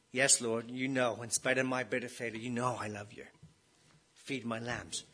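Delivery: soft, low, humble reply; hushed Peter. Acted. Preacher voicing Peter's humble reply to Jesus, softly. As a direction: voice Peter's answer gently, dropping away.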